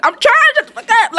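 high, fast mock-exasperated squeal; exasperated protest. Acted exasperated reply to critics.

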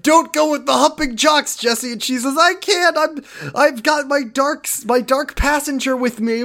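high pleading voice; high dramatic voice. Retold or imitated. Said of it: "Kyle is like".

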